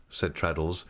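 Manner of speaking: plain reading voice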